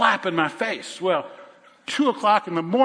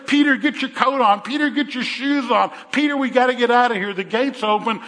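higher, louder, hurried urging; urgent angel; 6 semitones higher, 4 dB louder than usual. Acted. Angel hurrying dazed Peter out the prison gate, urgent and raised. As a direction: push him out the open gate.

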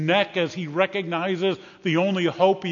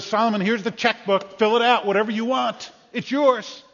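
higher, rising excitement; acted, raised-pitch giving; generous offer; 5 semitones higher, 3 dB louder than usual. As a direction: hand it over with emphatic generosity. Acts out God's generous offer.

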